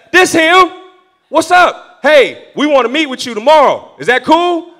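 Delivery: loud, high, punchy phone voice; imitated pushy caller. Man from the gym phones pastor, imitated pushy caller. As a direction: fire it off loudly as the caller.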